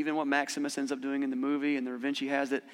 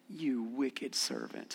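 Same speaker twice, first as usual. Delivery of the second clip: slow, much softer stern rebuke; a quiet, heavy rebuke spoken slowly; 3 semitones lower, 10 dB quieter, much slower than usual. Acted. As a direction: master quietly rebuking the servant, slow and soft. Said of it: "he said".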